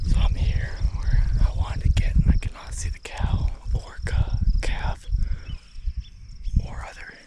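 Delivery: breathy hunting whisper; wildlife watching whisper. Real, not acted. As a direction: keep it to a whisper, elk are close. Stalking elk, keeping quiet.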